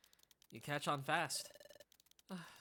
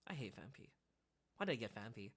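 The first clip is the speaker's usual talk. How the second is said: much softer annoyed mutter; grumbling aside; 6 semitones lower, 8 dB quieter than usual. Real, not acted. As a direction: complain to yourself.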